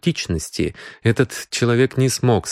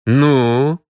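sharp insistent "Ну?", louder and higher; demanding; 4 semitones higher, 4 dB louder than usual. Acted. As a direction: bark it out as a demand. Detective presses for an answer.

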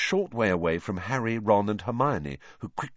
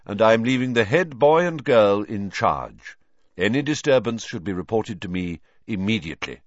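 higher, louder, grave headmaster voice; an old headmaster announcing a search; Dumbledore voice; 4 semitones higher, 5 dB louder than usual. A narrator's reading. Headmaster addressing the gathered students, in a commanding tone.

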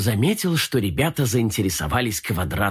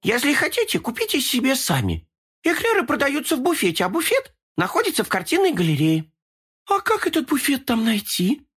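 very high cartoon kitten voice; squeaky little kitten speaking. Kitten characters talk.

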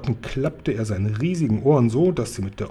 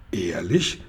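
low, amazed question; understated amazement, pitched down; astonished; 6 semitones lower, 4 dB quieter than usual. A narrator's reading. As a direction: ask in quiet disbelief. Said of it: "fragte Sophiechen bass erstaunt".

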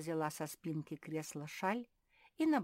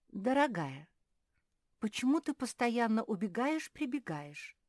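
curious; 7 semitones higher, 6 dB louder than usual. A narrator's reading. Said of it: "полюбопытствовала Софи".

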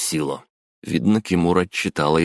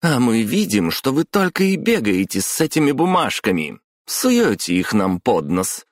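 high, heated accusation; indignant, higher-pitched tirade; raised voice; 8 semitones higher, 3 dB louder than usual. A narrator's reading. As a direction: throw complaints at the boss, voice lifted. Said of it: "повысила голос Майоко".

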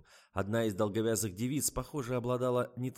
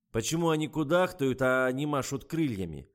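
loud, higher-pitched fault-finding; critical director; 4 semitones higher, 6 dB louder than usual. A narrator's reading. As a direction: demand an answer, annoyed. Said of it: "принялся критиковать режиссёр".

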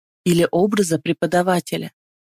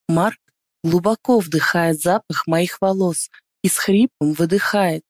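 ordinary delivery, nothing marked

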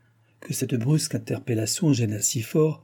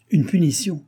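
higher, louder cry of alarm; alarmed outcry; 7 semitones higher, 5 dB louder than usual. A narrator's reading. The king recoils from Choiseul's proposal, alarmed outcry. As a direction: throw the word punition back in dismay. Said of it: "s'écria-t-il".

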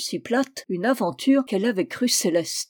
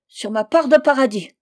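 higher, louder pleading vow; desperate oath; 4 semitones higher, 6 dB louder than usual. A narrator's reading. Trapped man swears to Fanta, desperately. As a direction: swear it with urgency.

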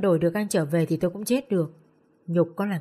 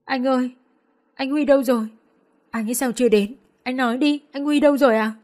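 sobbing desperately; 5 semitones higher than usual. Acted. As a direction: demand an answer through tears. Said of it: "òa khóc nức nở". (khóc nức nở, hỏi dồn dập)

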